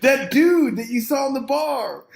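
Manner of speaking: high-pitched, eager party hail; imagined excited announcement. Retold or imitated. Kid presenting the guy from the bar, excited high voice. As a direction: hype up the guest to the crowd. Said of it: "I'm gonna be all excited".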